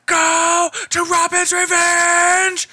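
a high, slow, frantic scream; manic Cage scream. Retold or imitated. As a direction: scream it like Nicolas Cage on fire. Quoting Cage movie line, screaming.

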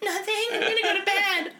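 high, put-on brush-off; hammed-up high innocent voice; mock innocent woman. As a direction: lilt it high as a pretend cover-up. Voices woman brushing off Sean Penn.

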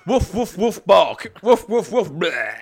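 playful woofs like a dog; dog barking. Pretend. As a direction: bark it out like a silly dog. Barking like a dog after sniff.

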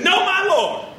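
high, loud exasperated protest; frustrated woman. Acted. Woman protesting to the prophet, frustrated. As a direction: push back in disbelief at the prophet. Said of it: "I think that's how she said it".

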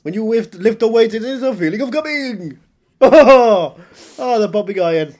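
put-on loud, high, bellowed Austrian accent; hammed-up action star bursting into laughs; Arnold Schwarzenegger. Bodybuilder gloating about pumping iron, bellowed accent. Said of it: "I am Arnold Schwarzenegger".

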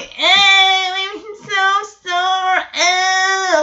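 high, drawn-out whiny groan; exaggerated achy whine, stretched out; mock moaning. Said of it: "And you moaning, going".

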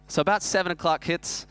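normal talking voice

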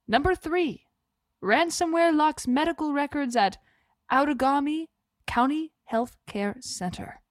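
lightly mock serious headline read. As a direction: read the headlines like a grave news anchor. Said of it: "This is my serious voice".

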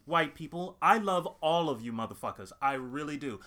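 ordinary talk

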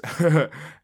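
a loud, high laugh bursting out; short burst of laughter. Real, not acted. Laughing at his own intensity.

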